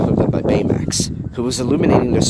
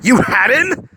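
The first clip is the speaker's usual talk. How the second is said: high, loud incredulous anger; angry reply; 7 dB louder, slower than usual. A narrator's reading. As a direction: throw it back at him, outraged. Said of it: "Fred replied angrily".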